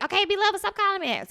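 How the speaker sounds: high, loud mock of the husband; mocking husband's plea. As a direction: tease him in a high, put-on voice. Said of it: "So every time he's like".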